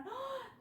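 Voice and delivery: a sharp, high intake of breath in wonder; delighted gasp. Pretend. Teacher gasps to show kids the paint magic. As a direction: gasp like you've just seen magic.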